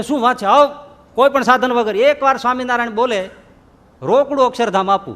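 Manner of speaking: high, loud, eager promise; eager exclamation. Acted. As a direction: jump at the idea. Kakabhai eagerly agrees.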